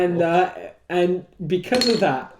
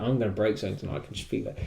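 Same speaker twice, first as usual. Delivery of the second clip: low, soft foreboding; quiet ominous prediction; 8 semitones lower, 10 dB quieter, much faster than usual. Retold or imitated. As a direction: murmur it like a hunch. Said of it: "I was like".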